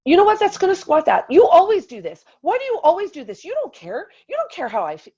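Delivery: high-pitched indignant tirade, starting loud, easing in volume; retold over-the-top rant. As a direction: re-enact an overblown marital scolding. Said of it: "And I completely went there".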